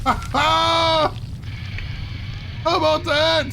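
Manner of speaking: high, loud gloating cheer; triumphant shout. Real, not acted. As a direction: crow over the beaten spider. Traps the spider with a bear trap.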